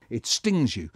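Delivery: plain reading voice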